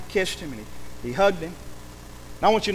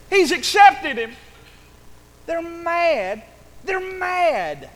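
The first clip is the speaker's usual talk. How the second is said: high-pitched, drawn-out mock outrage; scandalized onlookers; 10 semitones higher, slower than usual. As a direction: build from hushed gawking to high indignation. Acts out scandalized onlookers watching.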